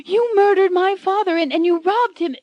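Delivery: high, loud, hammed-up accusing scream; heroine screaming. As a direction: shriek the accusation like a melodrama heroine. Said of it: "Anne screams".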